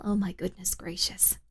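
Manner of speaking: breathy hushed whisper. Real, not acted. Streamer reacting to chat, whispering. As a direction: drop to a whisper, shocked. Shocked aside reading chat.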